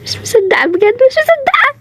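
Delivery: high, excited squeal of disbelief; can't believe it, squeal it high; giddy high-pitched disbelief. Acted. Overjoyed at the reconciliation.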